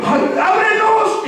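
high and slow, drowsy plea; a foolish virgin, still drowsy, begging at the shut door; sleepy voice. Acted. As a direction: drag it out as if half asleep. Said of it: "la voz de ella durmiendo, con sueño".

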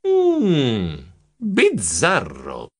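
very slow, suspicious musing; pondering. A narrator's reading. Narrator musing to himself. (riflessivo, lentissimo e sospettoso)

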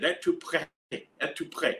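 "Êtes-tu prêt?" said as "et-too pray", repeated; clumsy French. Retold or imitated. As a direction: anglophone trying out "Êtes-tu prêt?". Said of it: "And I said".

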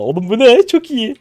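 high, loud amazed squeal. Real, not acted. Streamer squeals at his friend over a great play, amazed. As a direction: squeal with delight.

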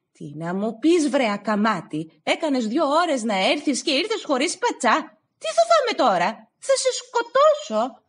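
building from scolding to loud high fury; mother raging over the lost tripe. Acted. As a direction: scold then explode at him.

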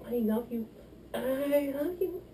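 normal talking voice

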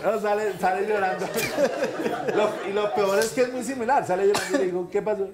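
high, loud, through real laughter; laughing while talking. Real, not acted. Husband resuming his story after a joke, laughing.

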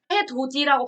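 해, 돋이 said as 해도디, with a plain d; d kept before the i in 돋이; unpalatalized 해돋이. Shown as a demonstration. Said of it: "발음 안 하죠".

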